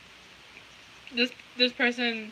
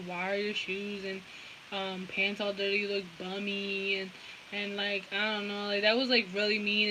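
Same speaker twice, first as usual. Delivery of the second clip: innocent act; 2 semitones lower, 7 dB quieter than usual. Retold or imitated. Telling others they were mocked for dirty shoes, quietly hurt. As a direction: play the wounded innocent, quietly and haltingly. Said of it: "an innocent little thing".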